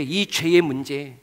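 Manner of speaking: usual speaking voice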